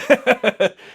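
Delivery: high, loud burst of laughter; big laugh. Real, not acted. Laughs at the punchline.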